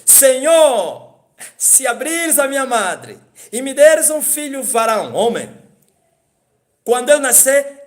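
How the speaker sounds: high, loud pleading vow to God; loud fervent vow. Acted. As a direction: cry out the vow to the Lord. Hannah's vow, preached loud. (voto suplicante em voz alta)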